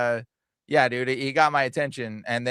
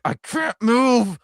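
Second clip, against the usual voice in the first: frozen man; 9 semitones higher, 5 dB louder, much slower than usual. Retold or imitated. Retold frozen man's reply.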